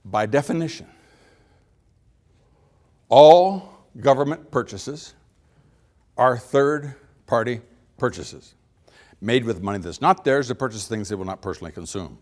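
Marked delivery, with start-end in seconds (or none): 0.0-8.5 slow and weighty, long pause before "all government purchases"; pauses for emphasis. Shown as a demonstration. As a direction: stretch "all government purchases", pause, then spell out "third-party purchases" slowly. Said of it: "I am now going to pause for emphasis".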